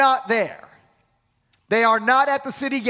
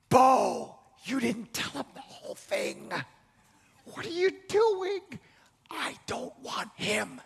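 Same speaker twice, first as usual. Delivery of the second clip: imitating Ruth; 2 semitones higher, 6 dB quieter than usual. Ruth protesting to Boaz.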